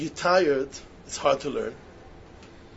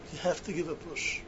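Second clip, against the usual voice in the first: echoed much softer, under the breath; quiet repeat; 10 dB quieter than usual. Shown as a demonstration. Preacher urging students quietly to push themselves. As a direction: drop the voice and let it sink in.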